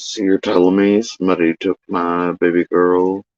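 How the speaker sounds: low, level monotone voice; imitating a man's low monotone. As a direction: say it in a deep, emotionless monotone. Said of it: "dude with the most monotone voice".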